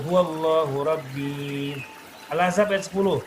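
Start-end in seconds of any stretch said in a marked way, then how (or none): 0.1-1.7 slow chanted "huwallahu rabbi"; resumed recitation. Shown as a demonstration. Teacher resumes after stopping, chanted "huwallahu rabbi". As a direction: start afresh on "huwallahu rabbi", holding the last i.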